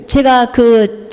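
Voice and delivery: ordinary talk, nothing marked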